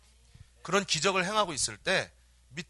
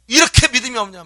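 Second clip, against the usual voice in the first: loud, high acted scolding; angry rebuke; 7 semitones higher, 13 dB louder than usual. Jesus rebuking his disciples for unbelief, angry outburst. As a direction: burst out at the faithless disciples. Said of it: "예수님께서 화가 났어요".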